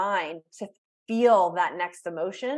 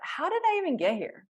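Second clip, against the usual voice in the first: higher, wondering how it happened; curious; 7 semitones higher than usual. Shown as a demonstration. Coach modelling a curious question to herself, curious. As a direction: ask it with open curiosity. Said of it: "being curious about it".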